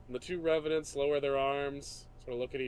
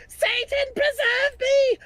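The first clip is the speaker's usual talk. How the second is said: high, shrill scream of terror; 11 dB louder, slower than usual. Acted. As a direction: shriek it out at the top of the voice. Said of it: "She screams".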